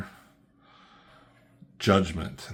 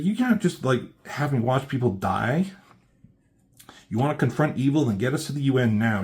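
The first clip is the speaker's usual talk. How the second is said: higher, louder heated demand; mock angry demand; 5 semitones higher, 4 dB louder, faster than usual. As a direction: push back hard and insist. Continuing the furious quote.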